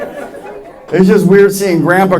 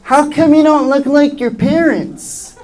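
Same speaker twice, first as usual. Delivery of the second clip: put-on high, slow child's question; high-pitched mock schoolfriend voice; puzzled kid friend; 8 semitones higher, slower than usual. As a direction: imitate a kid asking, high and drawn out. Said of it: "my friends were like".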